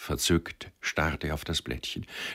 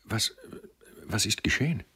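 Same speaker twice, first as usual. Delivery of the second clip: trembling; 4 semitones higher, 3 dB quieter than usual. A narrator's reading. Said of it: "stieß er zitternd hervor".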